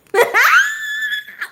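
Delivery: high shrieking burst of laughter. Real, not acted. Flustered by the song.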